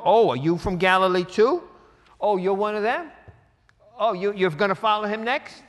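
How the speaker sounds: loud, high sneering sarcasm; sarcastic. Acted. Pharisees sneer at Nicodemus, loud and sarcastic. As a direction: taunt him as one of them. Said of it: "So you see the sarcasm".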